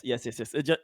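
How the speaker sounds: ordinary talk, nothing marked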